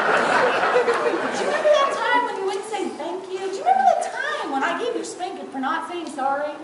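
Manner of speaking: wag a finger like a stern grandma; scolding grandma. Retold or imitated. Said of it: "she'd go".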